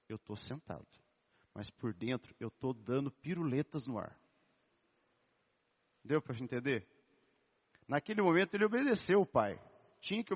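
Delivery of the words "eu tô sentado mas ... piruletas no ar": quiet, lower imitation of an outwardly obedient child; subdued boy. Boy answering his father after being made to sit, quietly acted submission.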